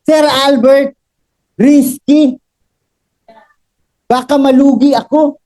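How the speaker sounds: mimicking the client. Seller mimics the client's fear of losing money, mock worried. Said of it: "sabi mo".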